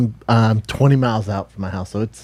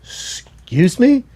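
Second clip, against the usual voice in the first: slow surprised excuse me; 11 semitones higher, much slower than usual. Retold or imitated. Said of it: "And I go".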